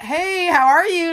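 high, slow put-on California greeting; bad fake California accent. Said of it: "fake California accent sounds bad".

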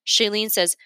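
plain reading voice

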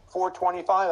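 pizza guy voice. Retold or imitated. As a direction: pizza guy answers the rookie, lightly mimicked. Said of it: "And he goes".

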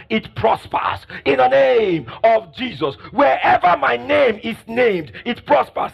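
loud, high fervent preaching; fervent sermon declaration; preaching at full force. Acted. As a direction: proclaim it to the congregation.

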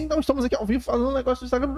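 high, hurried story-host patter; rushed Instagram story. Shown as a demonstration. Showing how to fit fifteen seconds on Instagram, rushed. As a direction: race through it like a fifteen-second livestream intro. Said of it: "você tem que falar assim".

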